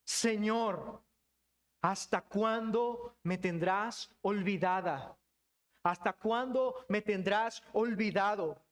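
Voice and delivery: slow, drawn-out plea to God; lament. Acted. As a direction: cry out how long, weary of waiting. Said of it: "tu lamento sonaría algo así como".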